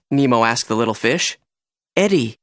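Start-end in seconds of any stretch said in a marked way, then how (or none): none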